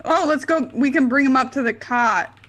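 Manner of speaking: ordinary delivery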